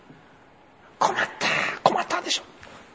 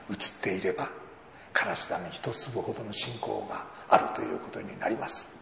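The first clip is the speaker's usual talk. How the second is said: softer, earnest prayer; quiet prayer; 4 semitones lower, 8 dB quieter than usual. Retold or imitated. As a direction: pray it alone at night. Old woman praying at night.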